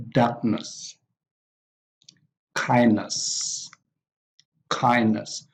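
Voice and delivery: MOVE-ment, TREAT-ment, HARD-ship, KIN-ship, DARK-ness, KIND-ness, each stressed at the front; first-syllable stress. Shown as a demonstration. Teacher models nouns with suffixes, first-syllable stress on Movement, treatment, hardship, kinship, darkness, kindness. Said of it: "stress is also on the first syllable".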